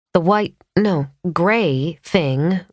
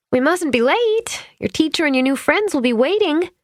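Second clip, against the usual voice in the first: cajoling; 12 semitones higher than usual. A narrator's reading. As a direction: sweet-talk a sleepy child along. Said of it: "she cajoled".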